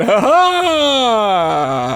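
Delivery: loud, high triumphant ha ha; dad's gotcha laugh. Retold or imitated. Prankster dad laughing at the scared couple, loud ha ha. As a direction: burst out laughing at your own prank. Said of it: "it's the dad going".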